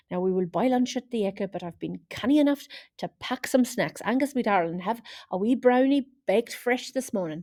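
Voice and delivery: put-on herd the kids along in a Scottish accent; Scottish mum rounding up the boys; thick Scottish accent. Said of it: "in her thick Scottish accent".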